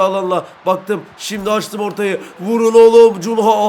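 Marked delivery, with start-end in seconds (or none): 2.4-3.7 loud, high yell to shoot; shouted order. Real, not acted. Gamer yelling at his striker to shoot, loud. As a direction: bark it at the striker.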